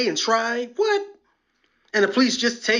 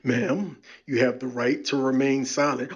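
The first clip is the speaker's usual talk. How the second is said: lower, steady cop voice; calm officer; 7 semitones lower, 3 dB quieter than usual. Retold or imitated. Officer reads rights to the arrestee, low and steady. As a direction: state the rights flatly like a patient officer. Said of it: "When they tell them".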